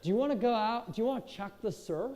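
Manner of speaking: soft, easygoing surfer invite; surfer bro. Retold or imitated. Surfer roommate inviting Kyle out, lightly voiced bro. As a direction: lazily invite him out like a beach bro. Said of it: "They're like".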